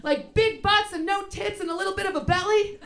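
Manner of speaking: loud high yelp, then an eager laughing question; eager agreement. Retold or imitated. Comic retelling her hopeful reply, loud high and laughing. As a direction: jump in thrilled, then laugh through the list. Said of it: "And I was like".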